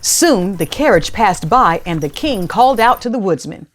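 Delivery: plain reading voice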